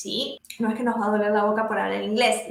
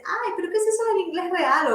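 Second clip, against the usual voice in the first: lightly imitating baffled students, pitched higher; mock puzzled, higher-pitched question; puzzled questioners; 5 semitones higher than usual. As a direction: mimic students doubting the term. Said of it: "Que muchos me dicen".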